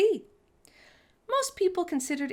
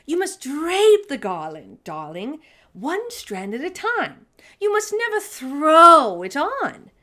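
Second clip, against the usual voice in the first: grand auntie; 6 semitones higher, 10 dB louder than usual. Acted. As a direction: insist like a grand lady. Auntie Claus voiced instructing.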